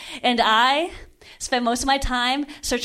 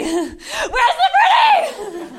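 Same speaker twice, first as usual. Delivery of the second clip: high, slow, laughing shout of Where's the pretty; hammed-up laughing shout; 12 semitones higher, 4 dB louder, much slower than usual. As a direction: yell Where's the pretty while laughing, stretched out. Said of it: "repeated, escalating".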